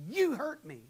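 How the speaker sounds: high, loud cry of hurt; sharp hurt outburst. Shown as a demonstration. Husband showing his wife an accusing outburst. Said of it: "Does that sound accusatory".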